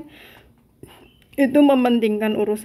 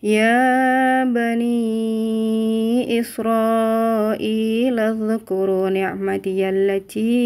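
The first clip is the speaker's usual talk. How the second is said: Quran recitation. Acted. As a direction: recite the verse with measured care.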